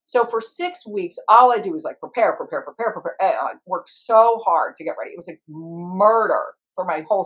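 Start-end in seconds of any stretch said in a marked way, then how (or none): none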